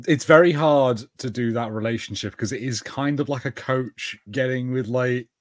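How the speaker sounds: ordinary talk, nothing marked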